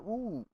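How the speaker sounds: high, drawn whoop; imitating his own high-pitched whoop; retold happy "ooh". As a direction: let out a high "ooh" to yourself. High retold exclamation to himself.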